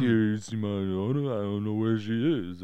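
campy action hero. Retold or imitated. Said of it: "they would have played it too campy".

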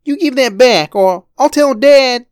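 put-on high, loud boy's threat; angry child. Boy threatens his brother with Dad, high loud anger. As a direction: threaten to tell Dad, near shouting. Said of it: "glared at him hotly".

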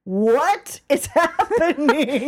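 slow, baffled laugh through the words; real incredulous laughter; laughing disbelief. Real, not acted. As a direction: laugh helplessly as you ask. Bewildered laughter after played clip.

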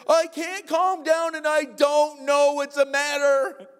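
put-on high, slow, wailing little girl voice; imitating a daughter too worked up to calm down; crying child. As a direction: whine it out like an upset seven-year-old. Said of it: "and she goes".

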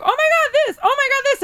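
high-pitched excited exclamation, retold; squealed mock "oh my God"; excited reaction. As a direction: re-enact gasping over a discovery, way up high. Acting out excited phone reaction.

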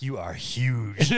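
slow, lightly voiced hippo lover; husband doing the inside-joke hippo line to his wife; deep hippo voice. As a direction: drag it out like the boyfriend hippo, half laughing. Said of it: "he's got this deep voice".

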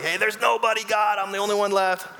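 high, insistent protest; acted complaint. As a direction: call up to God, hammed-up and aggrieved. Acting Elijah complaining to God.

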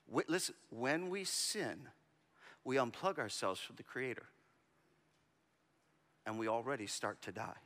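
low, soft intimate sermon voice; soft earnest preaching. Acted. To the congregation, applying the story, soft and earnest. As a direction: draw them in quietly, weighing each line.

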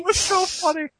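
high, laughing; amused. Real, not acted. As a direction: say it still laughing. Laughing about the sneeze.

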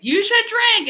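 shrill AI in his head; bossy squip voice. Retold or imitated. The squip urges him to drink.

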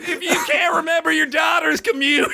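high, hammed-up Southern setup; Southern accent. Comic setting up the running joke again, Southern accent. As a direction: pitch it up like a mock Southern stand-up. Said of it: "that was a Southern accent".

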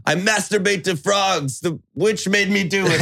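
giggling peasant confession; confessing villager. Retold or imitated. Townsman confesses, laughing.

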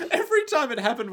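quick, high, laughing. Real, not acted. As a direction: laugh while rushing it. Laughing fast.

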